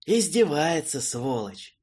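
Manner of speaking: angry hiss. Acted. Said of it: "злобно процедил маг".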